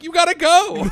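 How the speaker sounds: high, loud ejection order; kicking him out. Retold or imitated. Bartender ordering the spitter to leave, loud and high. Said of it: "I'm like".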